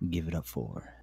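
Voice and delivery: low, growly stage announcer voice; a strip club MC, deep and dramatic; fighting game announcer. Shown as a demonstration. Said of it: "Can I practice my announcer voice?".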